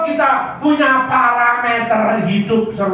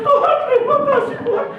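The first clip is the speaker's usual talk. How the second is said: mock tearful thanks; faster than usual. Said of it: "Dia berlutut nangis".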